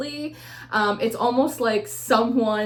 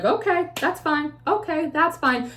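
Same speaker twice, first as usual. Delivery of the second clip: higher-pitched mock compliance; detached agreement; 7 semitones higher than usual. Woman who has emotionally unplugged answering her partner, detached high agreement. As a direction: imitate a checked-out girlfriend giving in, pitched up. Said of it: "she's emotionally de-invested".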